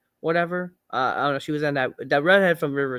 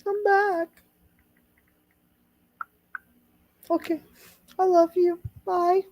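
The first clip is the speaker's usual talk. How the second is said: high silly voice; 13 semitones higher than usual. Pretend.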